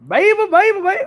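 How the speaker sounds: high, playful New Orleans "baby" imitation; New Orleans accent. Streamer gushing about her trip, mock New Orleans accent. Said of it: "I want they accent".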